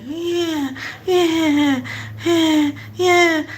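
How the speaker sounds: high, drawn-out indulgent yeahs; mock-cooing at a kid; demeaning baby talk. Adult humouring a kid doing something, high demeaning coo. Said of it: "a demeaning way to talk".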